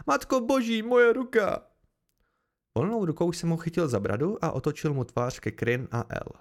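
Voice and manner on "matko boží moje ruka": high, loud cry of pain; bolestné vyhrknutí. A narrator's reading. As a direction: blurt it out in agony, pitch way up. (bolestné zvolání vysokým hlasem)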